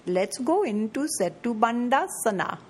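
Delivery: light playful Indian accent. Shown as a demonstration. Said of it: "you can add your little Indian accent".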